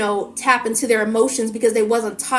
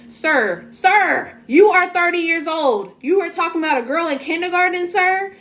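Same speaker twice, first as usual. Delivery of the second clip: high, disbelieving call-out of sir; incredulous scolding; 7 semitones higher than usual. Acted. To a grown man still hurt over kindergarten, high incredulous scolding. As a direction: talk down to him in mock disbelief.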